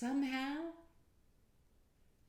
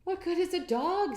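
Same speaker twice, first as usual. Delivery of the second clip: higher, louder protest; indignant Peter; 13 semitones higher, 12 dB louder than usual. Acted. Peter protesting to the wise men, higher and louder. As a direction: push back in disbelief.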